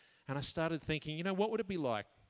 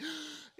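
high, sharp intake of breath; exasperated gasp; 13 semitones higher, 6 dB quieter than usual. Retold or imitated. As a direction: gasp as words run out.

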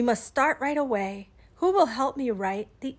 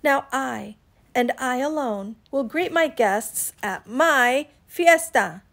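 emphatic telling-off, hitting each "I"; cross, self-righteous lecture; indignant scolding; 5 dB louder than usual. Acted. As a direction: list your work with mounting indignation, loud at the end. Iguana scolds friends in angry circles.

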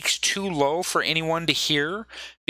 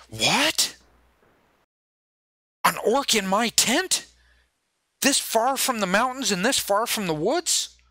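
higher-pitched, disbelieving questions; a ruler taken aback, each question rising; incredulous Thane; 7 semitones higher than usual. Acted. Voices Thane's instant shocked reaction.

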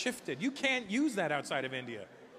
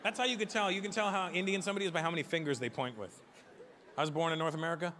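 usual speaking voice

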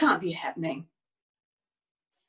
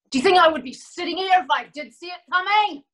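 high, very fast outburst; indignant retort; 5 semitones higher, much faster than usual. Acted. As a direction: fire back the question, exasperated. Acted angry retort.

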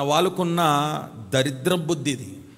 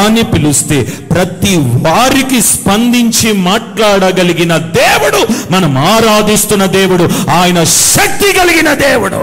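fiery preaching; 3 semitones higher, 13 dB louder, slower than usual. Real, not acted. Preacher proclaiming God's power to the congregation. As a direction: preach it out, slow and mighty.

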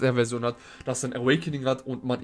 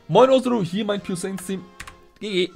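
hyped viewer greeting; 7 semitones higher, 7 dB louder than usual. Retold or imitated. Reading out a viewer's submission.

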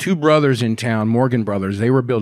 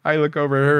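ordinary talk

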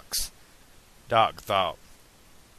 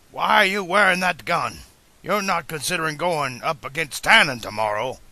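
higher, louder confrontation; demanding; 5 semitones higher, 4 dB louder than usual. A narrator's reading. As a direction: demand an explanation, voice raised. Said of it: "Doc demanded".

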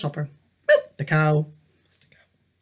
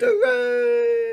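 high, bright cheer of triumph; 14 semitones higher than usual. A narrator's reading. Child cheering as the castle is saved, high cheer. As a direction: celebrate the rescue with a whoop.

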